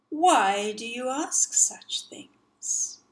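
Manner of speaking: slow, sly whispering crocodile; drawn-out menacing whisper. Acted. Sly crocodile answers slowly.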